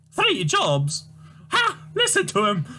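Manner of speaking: put-on high, wailing, scolding old mother voice; shrill old Scottish mum. Mother wails at son Tavish, high despairing scold. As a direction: play the despairing mother crying to the Lord.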